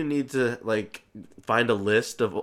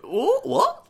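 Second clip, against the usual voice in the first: high, loud stammered disbelief; incredulous; 6 dB louder than usual. Real, not acted. Co-host balking at the British girl comment, incredulous. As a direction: squawk it in disbelief.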